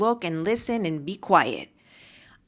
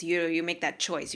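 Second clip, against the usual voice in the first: normal talking voice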